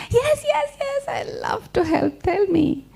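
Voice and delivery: eager duck. Acted. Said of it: "He says".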